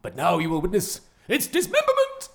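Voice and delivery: menacing cartoon villain, building to a loud boom; slow theatrical villain menace. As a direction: quote the planet-eating villain with grand menace. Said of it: "Oh, I wanna do a little voice".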